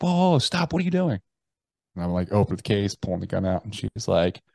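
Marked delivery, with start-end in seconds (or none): 0.0-1.2 much higher, urgent retold plea; panicked wife. Wife to husband opening the gun case, panicked. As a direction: imitate a wife trying to stop him.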